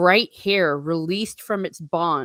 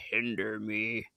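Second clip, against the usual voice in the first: much lower, softer menacing growl; deep menacing voice; 9 semitones lower, 10 dB quieter than usual. Shown as a demonstration. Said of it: "Actually, I should say".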